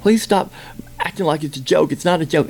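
high, pleading imitation of someone upset; worked-up plea, pitched high. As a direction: retell a plea from someone near tears. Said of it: "she was almost ready to cry".

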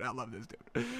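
breathy, barely audible adoring murmur; awed near whisper. Real, not acted. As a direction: let it fall to a hushed sigh.

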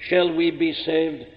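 normal talking voice

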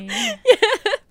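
very high, loud, near-crying laugh; shrieking laugh. Real, not acted. Speaker laughs at awkward moment.

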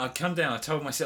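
normal talking voice

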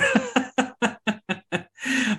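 long real laugh, pitched high; co-host laughing hard before teasing. Real, not acted. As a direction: let a drawn-out laugh roll.

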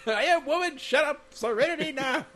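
high, loud, laughing shout; hammed-up angry dad shout; mock yelling back. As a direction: holler it back at her.

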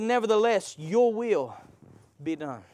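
hushed, yielding prayer; quiet surrender. Acted. Voicing Jesus' surrender.

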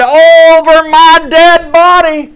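high, loud, drawn-out defiant shout; triumphant shout. Retold or imitated. Preacher voices Jesus defying death, shouting high. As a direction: shout it out slowly as a victory cry. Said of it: "Jesus shouted".

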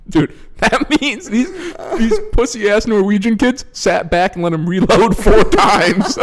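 high, loud, breaking into laughter; incredulous, losing it to laughter; laughing disbelief. Real, not acted. Comic telling friend about the reloads, laughing in disbelief. Incredulous, cracking up at absurd story.

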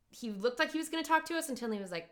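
ordinary talk

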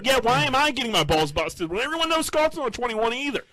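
high, incredulous complaint; indignant protest. Retold or imitated. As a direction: argue your case in high-pitched outrage.

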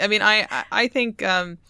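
normal talking voice

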